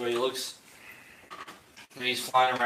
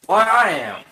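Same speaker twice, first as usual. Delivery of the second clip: gruff voice; 10 semitones higher, 15 dB louder than usual. Acted. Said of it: "a gruff voice".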